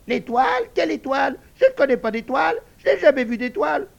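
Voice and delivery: very high, loud squeaky denial; shrill piglet protesting; lying piglet. Acted. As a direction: squeal innocence like a little pig. Narrator voices the piglet.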